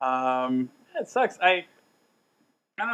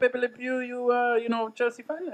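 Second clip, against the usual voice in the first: high-pitched cartoonish French accent; terrible French accent pitched way up; bad French accent; 10 semitones higher, slower than usual. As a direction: imitate a French fan, high and hammed-up. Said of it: "trying to do a French accent".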